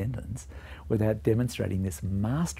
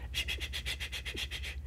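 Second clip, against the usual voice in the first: soft unvoiced brushing shh; whispered shh; 10 dB quieter than usual. Shown as a demonstration. Teacher imitating brushing teeth, soft rhythmic shh. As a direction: breathe the scrub of a toothbrush.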